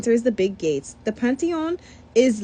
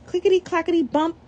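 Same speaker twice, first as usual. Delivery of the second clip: put-on higher, uneasy child voice; a boy getting scared; a touch of worried alarm; nervous; 8 semitones higher than usual. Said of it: "not afraid of these crazy calavera clowns".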